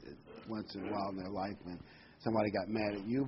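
usual speaking voice

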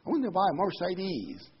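mock French accent at the car dealer; bad French accent. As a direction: order a Mercedes in a terrible French accent. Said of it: "that's bad French accent".

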